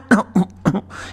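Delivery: nervous cough to cover a slip; awkward cough. A narrator's reading. Boy coughing when caught out. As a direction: cough to buy time. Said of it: "He coughed".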